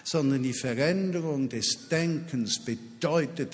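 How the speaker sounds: ordinary talk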